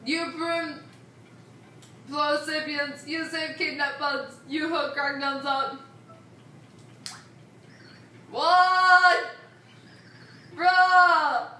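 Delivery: loud pleading brute; loud caveman voice. Acted. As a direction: bellow it like a caveman. Kragnon begging loudly.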